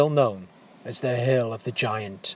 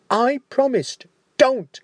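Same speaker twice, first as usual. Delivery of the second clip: much higher desperate plea; pleading; 11 semitones higher, 3 dB louder than usual. Acted. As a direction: cry out to stop them. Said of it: "Leo pleaded".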